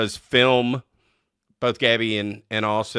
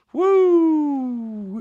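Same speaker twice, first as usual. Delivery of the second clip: excited whoop; 6 dB louder than usual. Real, not acted. Raving about the king cake.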